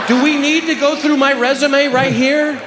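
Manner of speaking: high, slow, affronted protest; mock indignation. Comedian objecting to the judge's question, high indignant protest. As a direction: draw it out high, as if insulted.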